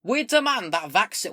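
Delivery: loud, high put-on northern accent; unplaceable northern-ish accent. Streamer reading manifesto heading aloud, botched northern accent. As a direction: declaim slowly in a vague northern accent. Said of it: "What accent is this?".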